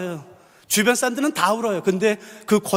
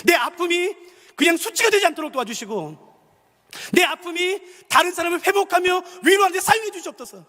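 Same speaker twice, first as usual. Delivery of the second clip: higher, earnest prayer voice; 6 semitones higher than usual. Shown as a demonstration. Pastor modelling a prayer for the congregation, earnest. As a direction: lift the words up as a plea. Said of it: "이런 기도하고 싶은 분".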